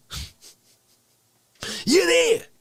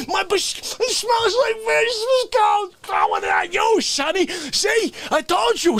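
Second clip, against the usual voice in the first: exasperated rant; 11 semitones higher than usual. Acted. Ranting character bit.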